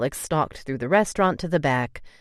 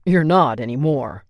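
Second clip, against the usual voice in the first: louder, final order; stern; 5 dB louder than usual. A narrator's reading. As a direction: lay down the law. Alex forbidding, loud.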